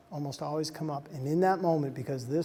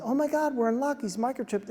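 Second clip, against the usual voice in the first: high-pitched, pleased announcement; excited relief; 7 semitones higher than usual. Retold or imitated. Shelter worker telling the old man good news, excited. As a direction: share good news in a raised, delighted voice.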